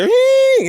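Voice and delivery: high piercing hing tone; sharp imitated ear ring. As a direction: mimic the ringing in the ear, high and loud. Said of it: "I can hear the".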